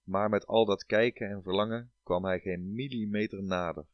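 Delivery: ordinary delivery, nothing marked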